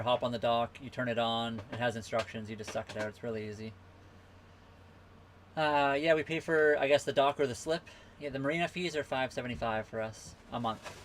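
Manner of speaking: usual speaking voice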